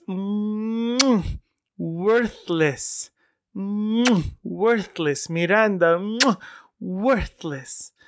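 put-on savoring Iranian-accented praise, high rising "mm" before each verdict; Iranian mom's chef's kiss. Mom congratulating the choreographer with the wrong word, savoring Iranian accent. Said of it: "like, a chef's kiss".

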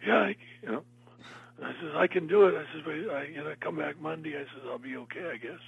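strained, high-pitched fake hoarse voice; pretending to be hoarse at an audition; feigned hoarseness. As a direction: squeeze it up high as if you've lost your voice. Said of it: "pretended that I was hoarse".

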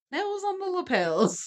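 high, playful sing-song on "Nails on the lapels"; sing-song rhyme. Shown as a demonstration. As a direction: savour the rhyme in a high lilt. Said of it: "that rhymed when I wrote it".

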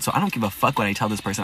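ordinary talk, nothing marked

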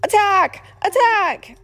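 high, loud battle cry; pirate shouting "Attack!" as enemies storm the ship; cry of alarm. A narrator's reading. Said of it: "Pegleg Pedro cried".

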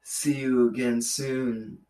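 soft, slow seductive sign-off; sultry. Pretend. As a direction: purr it like a porn star. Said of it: "That's why you're a porn star".

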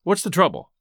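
ordinary delivery

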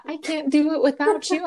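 high mock-sad wail; imitating tearful plea. Friend clinging at the goodbye, imitated tearful plea. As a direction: play up a friend's tearful pleading. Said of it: "saying all the saddest things".

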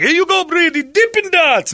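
high, loud put-on vendor voice; cheery vendor. Vendor greeting Brady with ice cream, high loud voice. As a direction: hand them over with big cheer.

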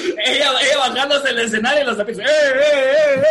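excited retelling. Retold or imitated. Friends laughing over a concert story, fast and loud. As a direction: tumble it out fast, then chant eh eh. Frog mascots dragging singer back.

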